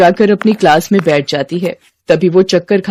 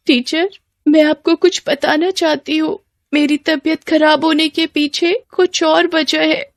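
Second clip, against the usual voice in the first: higher, softer confiding voice; meek schoolgirl; 9 semitones higher, 3 dB quieter than usual. Acted. Girl in hospital confessing to her teacher, meek and soft.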